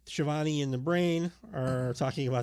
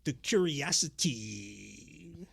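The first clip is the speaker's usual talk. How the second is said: Savage stretching for time; slower than usual. Acted. Savage not knowing what to say next, dragged out. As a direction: trail it out long and hushed. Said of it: "him stretching for time".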